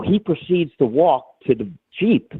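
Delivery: normal talking voice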